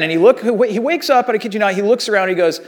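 ordinary talk

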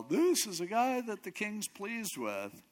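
high, drawn-out proclamation; retold yell. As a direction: announce it to the streets, slowly. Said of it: "I'm having to yell".

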